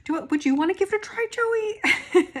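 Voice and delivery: high imitation of Charity inviting Joey to try the ATV; imitating Charity. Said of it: "she's like".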